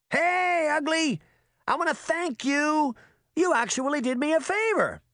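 put-on very high, cocky boy voice; taunting trickster boy. A narrator's reading. Trickster boy calls down to the girl, taunting. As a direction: call out high with mocking cheer.

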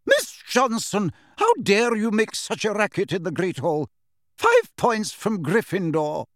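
high, clipped Scottish teacher's reprimand; a strict professor scolding a noisy pupil; stern Professor McGonagall. A narrator's reading. Professor rebukes Angelina in the Great Hall, stern.

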